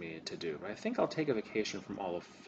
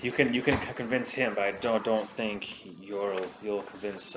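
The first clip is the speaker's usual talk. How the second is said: raised, louder and insistent; emphatic doubt; 3 semitones higher, 9 dB louder than usual. Acted. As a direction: push the doubt hard. NPC doubts Learman is convinceable.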